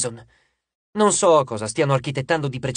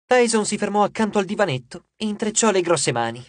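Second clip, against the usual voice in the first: ordinary delivery, nothing marked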